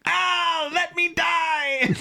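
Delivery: high, slow, shouted mock wail; melodramatic wail. Host mocks every alien in the episode, shouted mock wail. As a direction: overact an alien crying out to die. Mocking all aliens' dramatic pleas.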